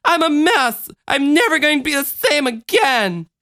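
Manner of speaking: put-on high-pitched, upset little-girl yell; shouting child. A narrator's reading. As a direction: wail it like a child in despair. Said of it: "the child shouted".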